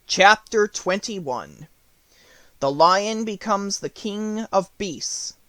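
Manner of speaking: slow, announced chapter heading; slow formal chapter announcement; chapter title. A narrator's reading. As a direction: read the title out slowly.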